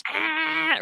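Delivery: high, grating screech from a comedy; mock irritating squeal; annoying noise. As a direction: make the most annoying noise you can, pitched high. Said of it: "most annoying noise in the world".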